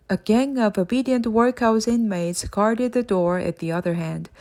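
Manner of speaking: usual speaking voice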